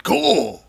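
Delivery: "Cole" high and stretched, falling at the end; drawn-out announcer emphasis. Shown as a demonstration. Host showing how the name must be said, "Cole" long and high. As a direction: swoop up loudly on "Cole" and hold it. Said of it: "You gotta say it like that".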